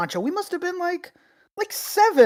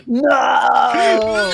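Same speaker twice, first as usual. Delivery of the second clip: shrieking laugh; 5 dB louder than usual. Real, not acted. Cracking up at a friend's joke, shrieking. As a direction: squeal no through laughter.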